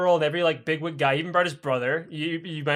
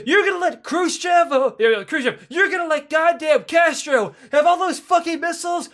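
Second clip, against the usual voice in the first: high, loud ranting; acted incredulous outrage; 11 semitones higher, 4 dB louder than usual. Kremlin officials challenging Khrushchev about Castro, acted outrage. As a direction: challenge him loudly at a high pitch.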